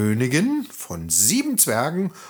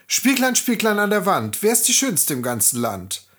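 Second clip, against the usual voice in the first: queen asking mirror; 9 semitones higher than usual. Acted. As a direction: call out to the mirror proudly. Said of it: "Als diese dann ihren Spiegel fragte".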